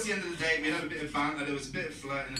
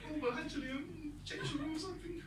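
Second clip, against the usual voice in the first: high, softer put-on waffle; mock sheepish backtracking; 11 semitones higher, 5 dB quieter than usual. Comedian imagines changing rules with his date, high sheepish mockery. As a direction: imitate an awkward climb-down in a raised voice.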